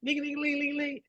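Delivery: high, soft ling-ling bell sound; imitated bus bell. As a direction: mimic the ding of a pulled stop cord. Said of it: "I pull that jank down".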